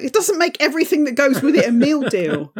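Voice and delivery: can barely get the manager's line out for laughing; laughing through a quote. Retold or imitated. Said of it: "He was like".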